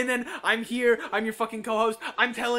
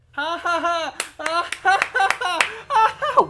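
loud, higher mock laughter; sarcastic fake laugh; 8 semitones higher, 9 dB louder than usual. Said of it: "One out of fucking ten".

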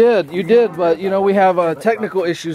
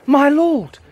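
"My lord" higher and heightened; hammed-up stage cry of "My lord"; theatrical line demo; 6 semitones higher, 3 dB louder than usual. Shown as a demonstration. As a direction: proclaim "My lord" like an actor on stage. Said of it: "And give me".

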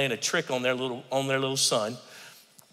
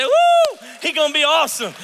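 excited cheer; 12 semitones higher, 7 dB louder than usual. Acted. Cheering a hopeful believer.

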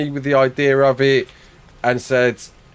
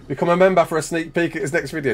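ordinary talk, nothing marked

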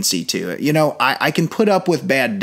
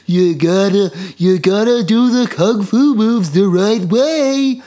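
put-on high, loud, slow old master voice; old kung fu master; 7 semitones higher, 4 dB louder than usual. Imitating the dubbed drunken master's first voice, high and loud. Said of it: "first he sounds like".

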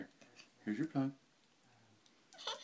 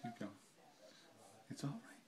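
soothing whisper; 11 dB quieter than usual. Real, not acted. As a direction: whisper it gently to calm him. Whispers to calm crying baby.

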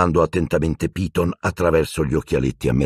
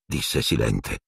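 ordinary delivery

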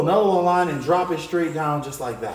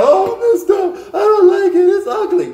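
high, loud mock whine of a kid; whiny kid complaint; 13 semitones higher, 8 dB louder than usual. Student complaining to the art teacher, high mock whine. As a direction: whine like a pouty student who hates the drawing. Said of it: "Don't be sitting there".

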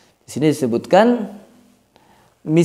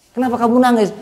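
asker; 8 semitones higher, faster than usual. Retold or imitated. Imitated asker in mock dialogue.

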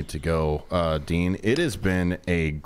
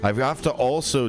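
ordinary talk

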